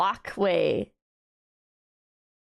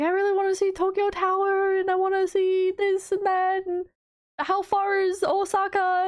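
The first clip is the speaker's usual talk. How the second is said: higher, excited mom voice, slowing at the end; imitating her mom; 9 semitones higher than usual. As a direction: gush like an excited first-time traveler. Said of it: "she's like".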